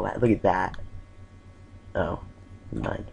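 usual speaking voice

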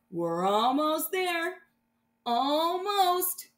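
slow, rising suspense; tense anticipation. Acted. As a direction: hold the breath, almost.

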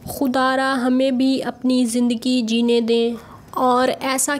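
slow, measured imitation of their way of talking; imitated slow speech. Vlogger retells their video statement in their slow manner, slow imitation. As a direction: mimic their slow, halting delivery, phrase by phrase. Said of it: "इस वजह से मैं ऐसे slow slow बोल रही थी".

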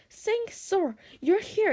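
high-pitched joyful relief. A narrator's reading. Hiccup thanks his rescuer dragon, high and joyful. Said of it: "he whispered joyfully".

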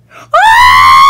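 loud, drawn-out scream; victory scream. Real, not acted. Gamer overcome by beating the game, screaming. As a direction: scream out the win.

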